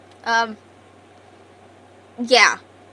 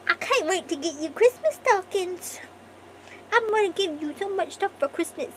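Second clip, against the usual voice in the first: put-on much higher baby talk to a kitten; gushing pet voice to a tiny cat; 8 semitones higher than usual. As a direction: coo at the cat like it's a baby. Cooing at her cat.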